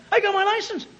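put-on high, thrilled teen voice; excited teenager. Teenager telling a parent about the new license, thrilled. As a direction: brag like a new driver. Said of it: "What a funny thing".

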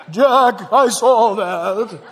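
exaggerated, drawn-out heavenly announcer; mock Holy Spirit voice. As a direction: ham up a slow, looming divine voice. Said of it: "he has a voice like this".

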